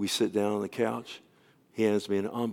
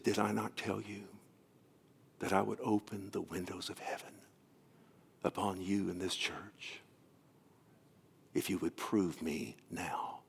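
hushed voice of God; 5 semitones lower, 12 dB quieter than usual. Retold or imitated. The Lord answers the pastor in his living room, retold solemn whisper. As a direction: breathe it out softly, pausing between phrases.

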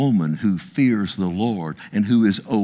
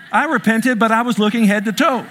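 higher, louder, chuckling through it; laughing confession; 10 semitones higher, 4 dB louder than usual. Real, not acted. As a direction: laugh while owning up. Confessing ogling his future wife.

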